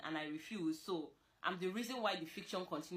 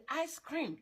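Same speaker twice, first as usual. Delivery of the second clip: higher, playful put-on voice; mock suggestion; 8 semitones higher, 3 dB louder than usual. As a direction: say it like the obvious magic word. Acting out the easy fix.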